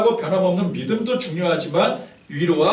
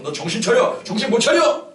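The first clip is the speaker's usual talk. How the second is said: mock furious shout, louder and higher at the end; imitating a scolding yell; 7 semitones higher, 6 dB louder than usual. Missionary scolding a trainee in front of everyone, mock yell. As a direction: bark it like a drill missionary. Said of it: "야단치고 그래요".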